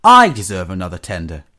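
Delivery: jealous, aggrieved grumble; indignant puffing. A narrator's reading. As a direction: insist loudly, feeling hard done by. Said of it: "he puffed".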